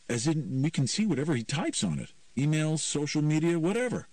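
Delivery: put-on high-pitched teenager explaining the obvious; matter-of-fact teen. Teen explains the keylogger to Myron, matter-of-fact teen. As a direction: lightly play a teen spelling it out.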